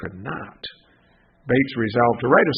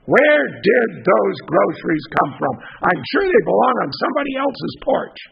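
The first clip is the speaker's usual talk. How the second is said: a touch of suspicious insistence; demanding; 5 semitones higher, 5 dB louder than usual. Acted. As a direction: press him for an answer. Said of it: "demanded to know".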